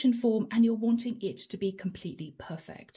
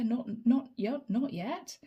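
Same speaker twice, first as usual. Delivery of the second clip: tripping over repeated words; stuttering. Real, not acted. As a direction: stumble and restart on the short words. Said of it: "I'm stuttering".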